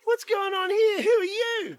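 high, blustering questions; hammed-up startled husband; Gerald, alarmed. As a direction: challenge the intruder in a fluster. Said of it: "And Gerald's all like".

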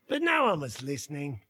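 normal talking voice

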